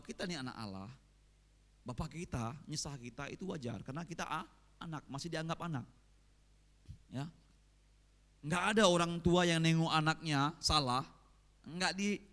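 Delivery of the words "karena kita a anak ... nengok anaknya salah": normal talking voice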